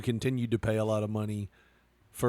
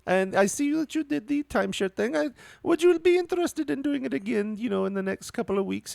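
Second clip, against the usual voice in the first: much higher, sing-song customer-service voice; chirpy hotel manager; 12 semitones higher than usual. Retold or imitated. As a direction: imitate a sugary sales manager greeting a guest. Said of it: "That's the way she talked".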